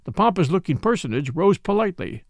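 ordinary delivery, nothing marked

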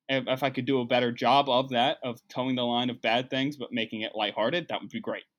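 ordinary talk

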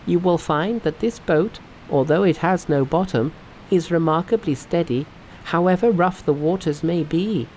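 high, assured character voice; Monkey. A narrator's reading. Monkey vouches for the bottomless boat, high assured voice. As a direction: reassure your master, pitch held high throughout. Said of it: "said Monkey".

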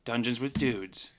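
dramatic closing, whispered title; previously on recap. Acted. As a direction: build up then whisper the name. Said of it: "Give it to us, [name]".

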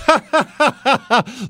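high, scornful put-on laugh; hammed-up dismissive laughter; mock laugh. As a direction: laugh off the threat as top dog. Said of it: "he says".

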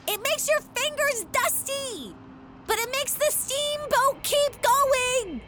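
high, pleading, nervous character; panicked whine about the nice list; high frantic character voice. Acted.

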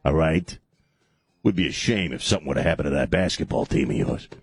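mock mobster threat.

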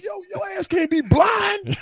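retold angry shout. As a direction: chew out the blocker. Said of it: "I said".

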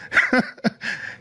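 high-pitched laugh at himself. Real, not acted. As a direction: burst into a squeaky laugh. Laughs at own bravado.

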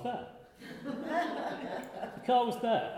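high laugh spilling into the words; laughing relief. Real, not acted. Relief at finding the car.

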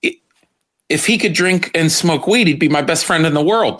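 normal talking voice

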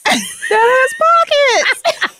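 high, drawn-out excited squeal; mock delight. Host gushes over a comfy dress, squealing in mock delight. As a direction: squeal it slowly with exaggerated glee.

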